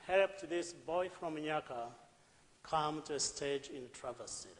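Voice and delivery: ordinary talk, nothing marked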